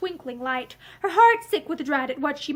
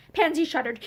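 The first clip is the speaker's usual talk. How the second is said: ordinary delivery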